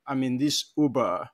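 usual speaking voice

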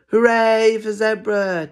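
high, loud, drawn-out cheer. Acted. Animals celebrating Zebra, cheering. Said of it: "cheered the animals".

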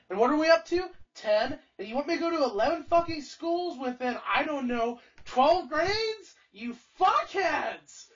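retold cussing out. To the principal and staff at the meeting, an imitated angry cussing out. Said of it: "I start cussing them fucking out".

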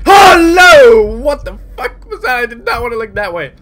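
high, loud shriek, easing slightly; startled screaming. Real, not acted. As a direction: scream in fright, then protest in panic. Jump scare while walking.

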